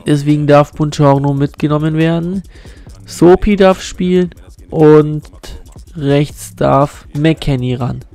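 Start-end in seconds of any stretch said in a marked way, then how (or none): none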